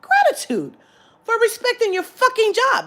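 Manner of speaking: high, loud declaration; worked-up, over-the-top thanks; emphatic gratitude. Retold or imitated. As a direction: proclaim it with exaggerated fervour. Emphatic thanks for doing the job.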